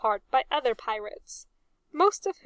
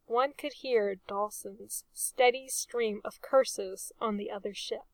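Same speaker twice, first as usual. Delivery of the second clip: usual speaking voice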